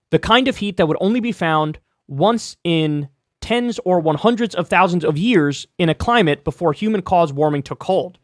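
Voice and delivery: ordinary talk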